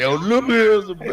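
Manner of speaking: mock the kids who loved Lugia; imitating hyped classmates. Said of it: "They were like".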